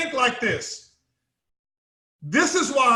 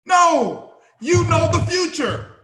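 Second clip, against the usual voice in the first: higher, louder confident call; louder, higher assurance; emphatic; 6 semitones higher, 3 dB louder, slower than usual. Real, not acted. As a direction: hit it with certainty. Confident answer to his question.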